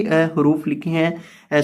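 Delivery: ordinary delivery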